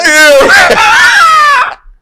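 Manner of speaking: high shrieking burst of laughter; loud shrieking laugh. Real, not acted. Friend asks an absurd question.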